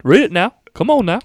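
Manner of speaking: put-on southern accent. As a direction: egg him on like a Southerner. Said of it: "gonna do a southern one".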